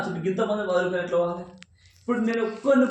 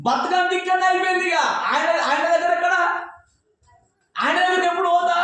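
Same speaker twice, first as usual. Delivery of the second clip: much higher, urgent sermon voice; impassioned preaching; 10 semitones higher, 3 dB louder than usual. Acted. Preacher voicing a soul tied down by six bonds, impassioned. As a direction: plead it like a man bound by ropes.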